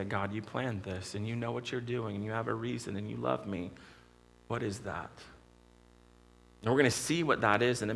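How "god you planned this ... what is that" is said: hushed prayer. Pretend. Preacher models a quiet trusting prayer to God, hushed. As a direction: pray it quietly, almost under your breath.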